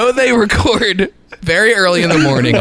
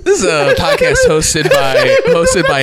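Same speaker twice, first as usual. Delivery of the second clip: laughing. Real, not acted. Laughing through intro.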